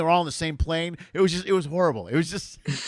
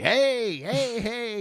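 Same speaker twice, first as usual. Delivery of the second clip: higher mock greeting, hey hey hey; fans calling out; 8 semitones higher, much slower than usual. Gym-goers calling out to the comic, higher playful greeting. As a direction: hail him across the gym.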